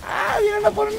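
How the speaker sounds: high alarmed cry; panicked yelp. Real, not acted. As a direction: yelp as they chase you. Enemies chase him.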